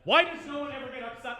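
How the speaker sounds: normal talking voice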